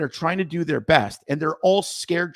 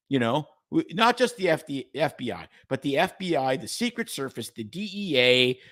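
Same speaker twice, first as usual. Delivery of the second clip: ordinary delivery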